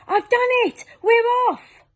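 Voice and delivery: very high, loud triumphant shout. Acted. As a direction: centipede bursting through the ceiling, triumphant.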